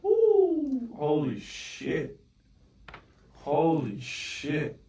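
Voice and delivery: high yelp then slow, drawn-out awe; real awestruck disbelief at the screen; stunned amazement. Real, not acted. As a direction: let the shock stretch the words. Trade suddenly flies into profit.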